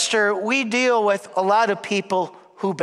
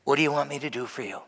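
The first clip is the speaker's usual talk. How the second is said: gentle question; 8 semitones lower, 7 dB quieter, much faster than usual. Retold or imitated. Jesus asks gently.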